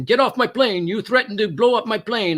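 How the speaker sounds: an angry pilot yelling at a passenger, retold. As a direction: order him off the plane in a loud voice. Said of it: "the pilot is yelling at one male passenger".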